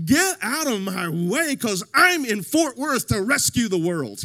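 slow, loud, bold; confident swagger. Retold or imitated. Jesus walking in his identity, slow loud swagger. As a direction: push past with swagger. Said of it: "got this transcendent awareness".

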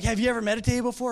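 mock excited woman quizzing him; hammed-up eager girl. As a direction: play her as a bubbly new friend, rushing. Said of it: "She's like".

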